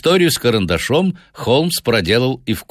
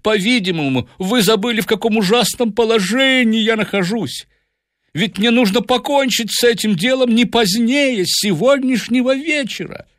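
high-pitched, anxious pleading; panicked professor voice; shocked and frightened. A narrator's reading. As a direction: plead as if about to be abandoned. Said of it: "Профессор был поражён и напуган".

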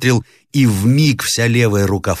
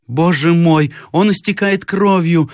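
alarmed second; 11 semitones higher, slower than usual. A narrator's reading. Second sees friend bleeding.

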